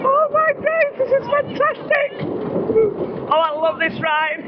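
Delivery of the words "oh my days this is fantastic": screaming with joy on a drop; whooping at full volume; excited screaming. Real, not acted. Riding the coaster drop.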